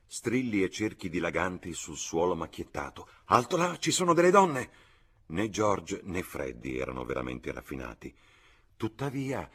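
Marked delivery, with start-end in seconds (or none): 3.3-4.7 high, loud warning cry; frantic high-pitched call to halt; changed, urgent voice. A narrator's reading. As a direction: shout a scandalised alarm. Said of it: "la sua voce cambiò".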